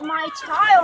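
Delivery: ordinary talk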